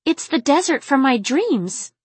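high, astonished exclamation; gasping. A narrator's reading. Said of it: "She obeyed and gasped again".

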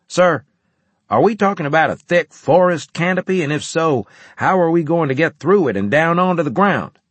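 high, loud soldier's voice; adjunct questioning commander. A narrator's reading. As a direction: raise a concern loudly to the commander.

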